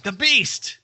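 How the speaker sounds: high-pitched mock-dramatic cry; alarmed exclamation. Reader voices the hangman seeing the beast, high mock alarm. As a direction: exclaim it like a comic-book villain caught out. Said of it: "The hangman's like".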